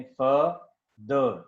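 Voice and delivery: फ and द said apart, a pause between; syllable by syllable. Shown as a demonstration. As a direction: break फादर into two separate beats, फ, द. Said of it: "अगर आप इसको देखें".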